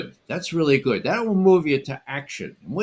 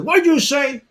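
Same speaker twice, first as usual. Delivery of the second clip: high, loud startled demand; retold sharp challenge; 7 dB louder than usual. Teacher wheels on the student, loud and sharp. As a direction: snap the question at the student. Jesuit turns, throws razor.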